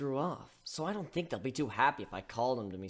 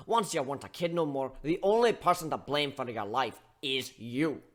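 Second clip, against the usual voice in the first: scolding bartender; 3 semitones higher, 7 dB louder than usual. Acted. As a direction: tear into him like a fed-up mentor. Steelhorn scolding continues.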